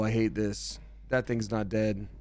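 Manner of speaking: ordinary talk, nothing marked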